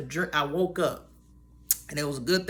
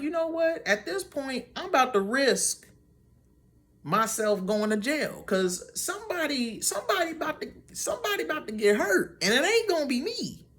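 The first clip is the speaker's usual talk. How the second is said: much higher, fierce warning; heated threat; 8 semitones higher than usual. Retold or imitated. Narrator warns the mom's group that someone will get hurt, in a much higher heated voice. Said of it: "I was like".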